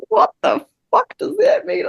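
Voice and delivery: high, slow incredulous disbelief; stunned, not getting it at all; baffled. Retold or imitated. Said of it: "And I was like".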